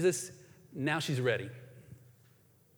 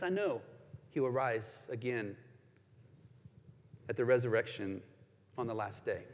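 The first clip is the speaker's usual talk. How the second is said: hushed and low; 7 semitones lower, 9 dB quieter than usual. Shown as a demonstration. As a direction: let it sink low and quiet. Rereads Martha's words, hushed.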